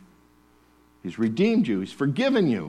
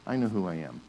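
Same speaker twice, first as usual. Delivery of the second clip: quiet assurance; 4 semitones lower, 6 dB quieter than usual. Retold or imitated. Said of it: "And you say".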